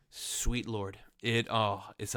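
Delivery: usual speaking voice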